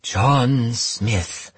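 fortune-teller voice. Acted. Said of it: "put on her best fortune-teller voice".

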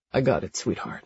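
a flirty man, unhurried and low; deep male voice. A narrator's reading. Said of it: "male love interest voiced".

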